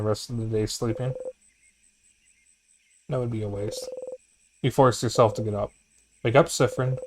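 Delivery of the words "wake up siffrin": ordinary delivery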